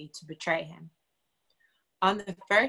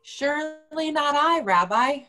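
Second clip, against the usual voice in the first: higher and louder, set apart from the narrator; a raised, protesting denial; Judas denying; 6 semitones higher, 5 dB louder than usual. Acted. Judas denying to Jesus, higher and louder.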